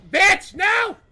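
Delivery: angry yell; loud frustrated shout. Real, not acted.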